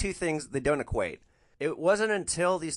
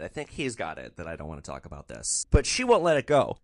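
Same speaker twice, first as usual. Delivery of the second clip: normal talking voice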